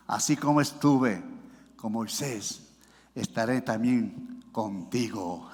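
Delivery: low, soft, with pauses between phrases; hushed promise. Acted. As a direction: bring God's promise right down, quietly and deliberately. Quietly repeats God's promise after exhortation. (promesa pausada, en voz baja)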